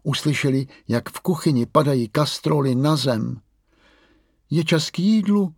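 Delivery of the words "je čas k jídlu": polekaně. A narrator's reading. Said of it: "lekla se ... ještě rozespalá".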